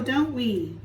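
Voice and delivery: plain reading voice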